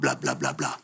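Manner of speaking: mock chatter. Mocking endless complaint.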